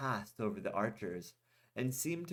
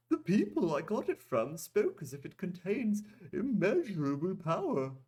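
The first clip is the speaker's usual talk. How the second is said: high put-on merchant voice; Goldak voice; 9 semitones higher, 3 dB louder than usual. A narrator's reading. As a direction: redo the line as the merchant, pitched high. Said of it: "wrong voice, I'm sorry".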